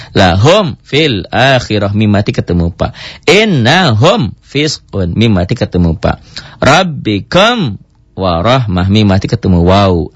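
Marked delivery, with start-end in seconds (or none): 3.3-5.1 "Innahum fisqun" read slowly, with the m held clear before the f; clear izhar drill. Shown as a demonstration. Teacher drilling students on clear mim before fa. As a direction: keep the mim distinct, slow and careful. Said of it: "Itu untuk melatih".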